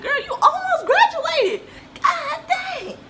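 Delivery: very high, loud squeal; hammed-up, high-pitched outburst; mock exasperation. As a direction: squeal it in laughing disbelief. Retells protesting mom's pregnancy fear.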